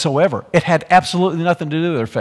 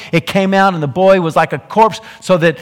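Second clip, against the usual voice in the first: ordinary talk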